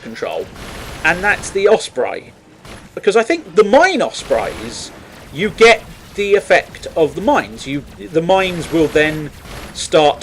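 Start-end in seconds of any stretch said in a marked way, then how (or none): none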